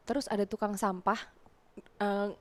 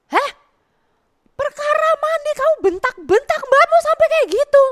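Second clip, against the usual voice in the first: high, loud incredulous scolding; loud shocked mother scolding; 12 dB louder than usual. Retold or imitated. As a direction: scold the kid in disbelief.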